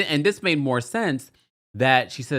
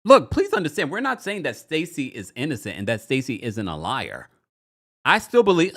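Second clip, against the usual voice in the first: normal talking voice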